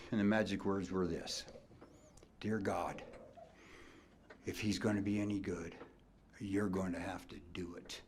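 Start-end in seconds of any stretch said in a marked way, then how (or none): 2.4-8.0 whispered prayer. Retold or imitated. Coach's desperate prayer to God.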